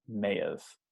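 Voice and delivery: "may have" linked with a faint v sound in between. Shown as a demonstration. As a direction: glide "may have" together with a tiny v. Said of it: "you hear this little sound in between".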